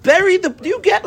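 very high, incredulous squawk; retold exasperated outburst. Emotional student to his literal-minded partner, exasperated and incredulous. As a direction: throw the word back at him, voice leaping up.

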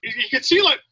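ordinary delivery

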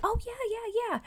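mock eager agreement. Stranger gushing at a first meeting, mock eager high voice. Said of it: "and they're like".

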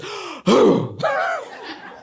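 high, sharp gasp for breath; squeaky exaggerated gasp; imitated child gasp. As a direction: mimic a toddler's big inhale. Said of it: "She goes".

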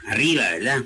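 usual speaking voice

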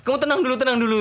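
high-pitched, urgent calming down; retold flustered teacher trying to settle someone; acted panic. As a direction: try to soothe while panicking yourself. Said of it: "Saya kan jadi panik juga".

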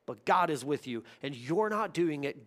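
ordinary talk

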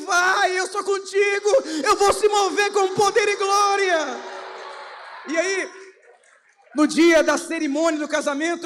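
loud, fervent pulpit preaching; preaching at full force. Acted. Pastor stirring his congregation, loud fervent preaching. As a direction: preach it out, building the fire.

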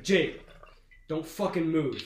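imitating a mom's stern order. Mom catching her high son, stern.

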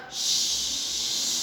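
long unvoiced hissing s; snake hiss. Shown as a demonstration. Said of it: "yang S kayak ular".

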